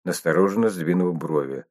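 ordinary delivery, nothing marked

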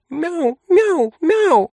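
high earnest cat yowls; heartfelt meowing. A narrator's reading. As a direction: meow with feeling like a cat. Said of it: "длинной прочувствованной речью".

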